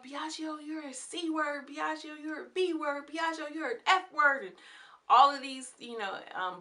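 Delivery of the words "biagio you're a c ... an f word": high-pitched mock tirade, building louder; going off. Locked-out woman cursing Biagio through his door, mock going off. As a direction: imitate her ranting at him, pitched well up. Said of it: "she's going off".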